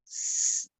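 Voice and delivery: whispered hissing "स" with no voice; isolated s phoneme; s sound. Shown as a demonstration. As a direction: breathe out a bare "स" hiss. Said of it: "एस को क्या बोलते हैं?".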